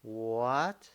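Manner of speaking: "what?" drawn out with a rise; long upward questioning "what?"; rising second tone. Shown as a demonstration. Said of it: "pronounce the second tone".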